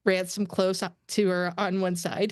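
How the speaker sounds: ordinary delivery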